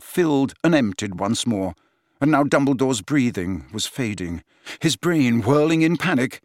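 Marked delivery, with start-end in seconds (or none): none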